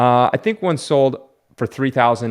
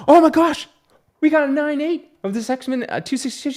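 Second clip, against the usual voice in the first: excited. Retold or imitated. Collector discovering a rare grade while girlfriend talks, excited. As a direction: burst out like you hit the jackpot. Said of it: "I go".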